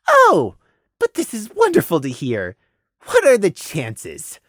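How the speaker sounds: high, drawn-out 'Oh', then savouring it; gloating delight. Acted. Cruel mind reader on hearing a mare's despair, gloating delight. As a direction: relish someone else's misery. Villain delights in another's misery.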